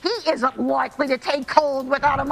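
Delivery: ordinary talk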